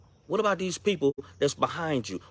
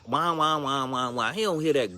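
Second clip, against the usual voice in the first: normal talking voice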